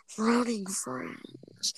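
very slow, whispery drawn-out voice; crazed boss. Retold or imitated. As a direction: stretch each word like an unhinged boss. Quotes crazed boss's favorite line.

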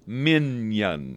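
"minion" stretched out slowly; drawn-out MINION. Shown as a demonstration. Host explaining the key to the joke, "minion" stretched slowly. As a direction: lean on "minion", drawing out both syllables.

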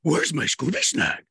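slow cartoon dog voice; Scooby-Doo voice. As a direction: whine for the snack like Scooby. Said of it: "Je le fais mieux". (voix de Scooby-Doo)

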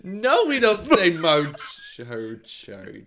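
loud, high Australian denial; Australian accent. Retold or imitated. Australians denying how they say it, loud Aussie accent. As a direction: shout it like an offended Aussie. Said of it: "They're like".

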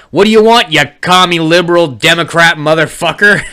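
loud joking taunt; mock insult. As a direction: tease mom with a fake insult. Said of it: "And I was like".

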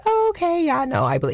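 squeaky, high, eager agreement; gullible high voice. Retold or imitated. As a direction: sound sweetly naive and trusting. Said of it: "I was just like".

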